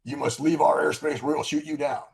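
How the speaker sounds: lightly acted hostile intercept call; radio warning. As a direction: imitate an official challenge over the radio. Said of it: "they got on the radio and they say".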